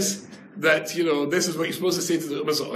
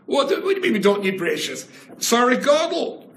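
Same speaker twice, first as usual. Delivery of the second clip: outraged; 8 semitones higher, 4 dB louder than usual. Retold or imitated. Voicing Ramban's outrage.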